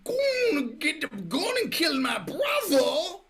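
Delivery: very high, loud, drawn-out Cockney yelling; over-the-top Cockney caricature shout; annoying Cockney. Acted. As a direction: screech it as a hammed-up Cockney. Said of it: "that's probably Cockney".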